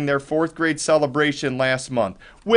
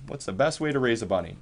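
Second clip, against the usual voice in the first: quiet repeat; 5 semitones lower, 8 dB quieter, faster than usual. Shown as a demonstration. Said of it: "What is the best way to raise a bunny?".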